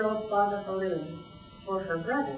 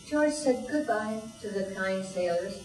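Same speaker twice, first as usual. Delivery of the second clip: plain reading voice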